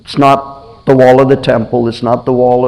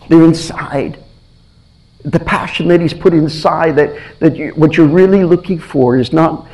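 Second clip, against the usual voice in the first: ordinary delivery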